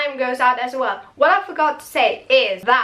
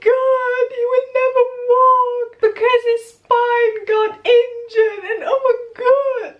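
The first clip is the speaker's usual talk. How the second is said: high-pitched, hammed-up wailing; mock crying; 12 semitones higher, 3 dB louder than usual. Mother wailing about an injured son's spine, mock crying. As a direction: imitate a melodramatic mother sobbing out bad news. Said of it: "Mama David comes crying".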